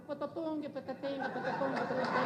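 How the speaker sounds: soft, rhythmic mouth sound effects; light percussive patter; flying-sandwich noises. Retold or imitated. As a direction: imitate things whizzing back and forth in a steady beat. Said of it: "the sandwiches are going".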